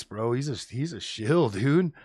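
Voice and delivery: usual speaking voice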